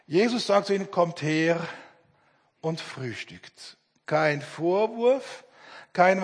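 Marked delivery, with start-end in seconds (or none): 0.9-3.8 soft, slow and low, almost hushed; gentle invitation. Acted. Jesus gently inviting the ashamed disciples, soft and slow. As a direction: invite them kindly, no reproach.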